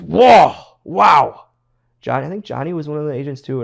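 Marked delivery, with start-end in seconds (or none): 0.0-1.4 higher and louder "whoa, wow"; impressed mock exclamation; surprised whoa. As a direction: react like you just saw a wild bump. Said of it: "And Fit goes".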